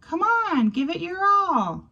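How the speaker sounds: high squeaky mouse cheering him on; high little mouse voice, urging. Acted. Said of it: "Marvin squeaks".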